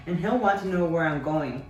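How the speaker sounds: ordinary delivery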